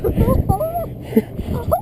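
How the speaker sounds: high whooping laugh of joy; delighted high laugh. Real, not acted. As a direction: laugh out in triumph. Laughing after the catch.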